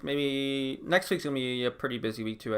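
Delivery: ordinary talk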